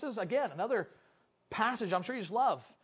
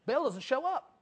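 usual speaking voice

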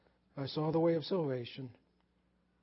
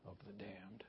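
hushed whisper on damned; a preacher whispering the word of doom; 9 semitones lower, 21 dB quieter than usual. Acted. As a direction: drop to a grave whisper. Solemn warning about hell.

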